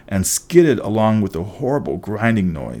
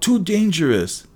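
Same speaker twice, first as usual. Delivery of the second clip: high alarmed cry; wailing; 9 semitones higher than usual. A narrator's reading. Gray sisters protest the threat to their eye, high wail.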